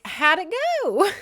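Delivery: high, loud sing-out question; mock-cheerful, voice jumping way up; chirpy inquiry. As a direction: call it out high, comically oblivious. Belle's comic chirpy question.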